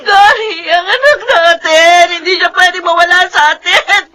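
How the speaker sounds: desperate sobbing. Acted. Mother refuses baby is dead.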